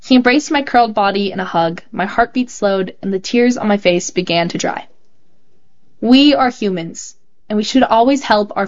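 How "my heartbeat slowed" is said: plain reading voice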